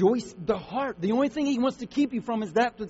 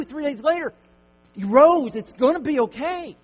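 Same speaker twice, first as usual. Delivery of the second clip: higher, louder preaching; full-force preaching; 5 semitones higher, 5 dB louder than usual. Acted. Pastor assuring the church God is in control, preaching loud. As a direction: build it up and proclaim.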